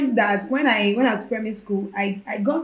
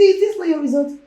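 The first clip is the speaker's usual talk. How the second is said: higher, louder, incredulous push; insistent question; 8 semitones higher, 7 dB louder than usual. Retold or imitated. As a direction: make her believe it, pressing. Lecturer insisting she believe it.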